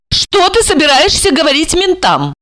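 high, demanding stepmother; stern questioning. A narrator's reading. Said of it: "строго спросила она".

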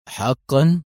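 drawn-out, chewed-up word; relieved chewed mumble. A narrator's reading. Hotelkeeper to the police, relieved lazy mumble. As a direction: mumble it lazily round a cigarette. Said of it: "غمغم الرجل في ارتياح وهو يلوك الكلمات بشفتيه".

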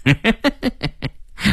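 villain's stuttering chuckle; menacing laugh. Acted. Gunman laughing at Qi Jia's nerve, menacing. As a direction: chuckle darkly at the defiance.

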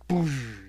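explosive "boosh" of impact; crash sound effect. Retold or imitated. Storyteller voices turtle hitting the ground, explosive crash. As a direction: burst it out like a crash. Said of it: "he hits the ground".

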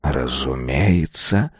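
low, slow, soft voice of a venerable master; quietly lofty, drawn out; self-assured elder. A narrator's reading. Divine elder nods. (низко, тихо, величаво)

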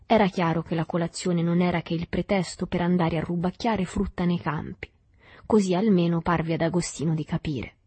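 ordinary delivery

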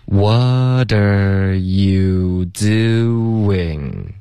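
every syllable stretched very long; super-slow pronunciation drill; extremely slow demo. Shown as a demonstration. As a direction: draw each word right out for learners to copy. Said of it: "我们再说几遍".

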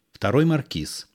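ordinary delivery, nothing marked

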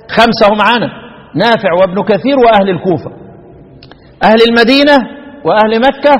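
normal talking voice

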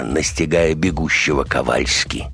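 ordinary delivery

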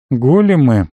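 musing, drawn-out "Големы"; thoughtful drawl. A narrator's reading. Narrator ponders the soldiers, thoughtful drawl. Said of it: "задумчиво протянул я".

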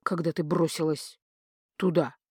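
low, subdued, dry voice. A narrator's reading. Sorcerer recalling his wife's rush into danger, dry. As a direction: finish the report drily and low. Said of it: "сухо сообщил Акар".

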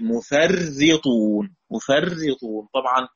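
مفرطون said mufarritun with a doubled r; مفرطون as mu-far-ri-tun, r held double with kasra; مفرطون with shadda on the r; Abu Jafar's reading. Shown as a demonstration. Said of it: "وأبو جعفر بالتشديد".

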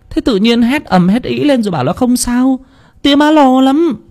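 very high, put-on female voice; worried mother. A narrator's reading. Worried mother chides her son. (giọng má rất cao, trách vì lo)

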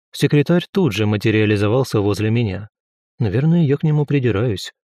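ordinary delivery, nothing marked

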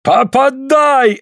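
very high, drawn-out shout; yelling. A narrator's reading. Suль dares Andrei to strike, yelling. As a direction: yell the command high and long. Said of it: "орёт Суль".

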